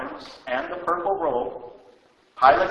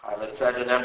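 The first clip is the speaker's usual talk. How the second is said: ordinary delivery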